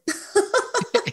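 motorboat lip buzz; lip trill. Shown as a demonstration. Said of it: "this motorboat sound".

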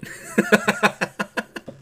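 breathy high burst of laughter; laughing at own joke; high unvoiced laugh. Real, not acted.

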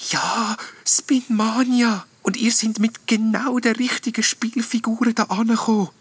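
very high, wispy ghost excitement; excited little ghost. A narrator's reading. Little ghost confirming to the knight, excited. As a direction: draw out the name, high and thrilled. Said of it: "sagt der kleine Geist aufgeregt".

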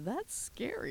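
Garfield voice. Acted. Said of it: "Garfield looks at him and says".

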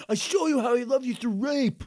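high, loud put-on Biff voice. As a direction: ham up the bully. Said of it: "That was Biff".

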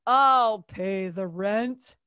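heroic Dudley Do Right. Acted. Dudley Do Right rescuing the damsel, slow heroic declaration. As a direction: announce it like a square-jawed cartoon hero. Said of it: "Here comes Dudley Do Right".

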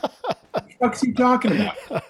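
high, laughing bafflement; puzzled, half-laughing disbelief; baffled. Retold or imitated. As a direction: imitate your own bewildered reaction, high and soft. Baffled reaction to the shout.

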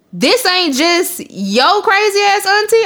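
high, slow, disbelieving question; a mother caught off guard, voice jumping up; mock shocked mama. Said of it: "She was like".

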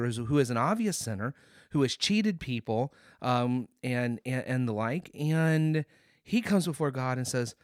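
normal talking voice

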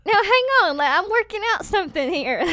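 loud, high plea to wait; mock exasperated protest. Praying woman puts off a well-meaning encourager, mock exasperated. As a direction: fend off an interruption, playfully indignant.

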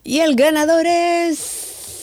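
high, slow announcer build-up. Pretend. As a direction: drag it out like a host. Mock award show announcement.

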